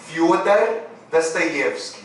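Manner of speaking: slow, careful Russian "Fyodor Dostoiévski"; "Fyodor Dostoiévski" drawn out, unstressed o as a; Russian pronunciation. Shown as a demonstration. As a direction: say "Fyodor Dostoiévski" slowly as "Fyodar Dastayevski". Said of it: "é por isso que eu vou falar".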